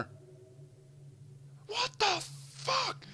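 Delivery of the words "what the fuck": very high, drawn-out cry of outrage; retold rage. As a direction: stretch each word high in furious disbelief. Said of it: "There is so much rage".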